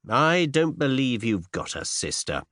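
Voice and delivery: usual speaking voice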